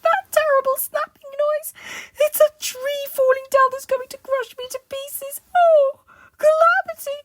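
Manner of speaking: high, loud, hysterical babbling; panicked shriek. Acted. Mister Jelly imagines crocodiles in the woods, hysterical shrieking. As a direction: shriek in runaway terror, breaking off abruptly. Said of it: "he shrieked".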